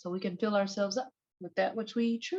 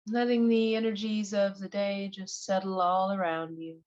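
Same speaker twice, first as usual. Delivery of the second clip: guided meditation. Acted. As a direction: ease them gently into relaxation, one unhurried phrase at a time.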